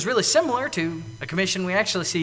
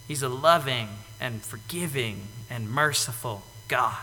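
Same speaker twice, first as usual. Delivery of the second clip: ordinary delivery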